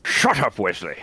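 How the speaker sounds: stern starship captain voice; Picard mayor voice. Acted. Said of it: "In your mayor voice, [name], say".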